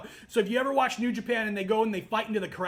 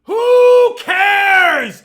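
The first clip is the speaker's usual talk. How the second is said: bursts out yelling, much louder; angry shout; 6 semitones higher, 15 dB louder, much slower than usual. Real, not acted. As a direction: blow up at the fans. Ranting against WWE fans.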